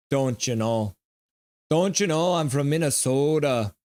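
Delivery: hammed-up Minnesotan with long Os; Minnesota accent. Shown as a demonstration. Streamer performing for a viewer, hammed-up Minnesota accent. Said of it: "say Minnesota with a Minnesota accent".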